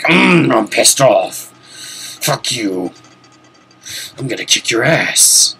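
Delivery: a hint of a gruff action hero; Duke Nukem. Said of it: "doing a Duke Nukem impression".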